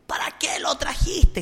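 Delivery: high, slow, eerie demon voice rising to a whispered shriek; retold demon voice of a possessed girl; possessed girl screaming. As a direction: channel the demon in the girl, accusing. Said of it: "gritaba la niña endemoniada".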